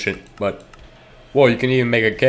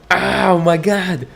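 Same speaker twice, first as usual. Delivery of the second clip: "Oh my God" slow and higher, almost laughing; imitating the neighbor; 8 semitones higher, much slower than usual. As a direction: stretch "Oh my God" out like a half-laugh. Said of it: "it's kinda like laughing almost".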